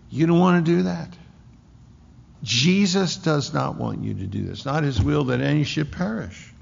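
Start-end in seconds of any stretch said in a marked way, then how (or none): none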